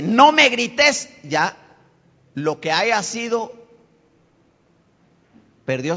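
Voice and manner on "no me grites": high, loud, slow objection; raised-voice mock protest; acted protest. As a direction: play the offended person pushing back. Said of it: "Pero cuando le dice".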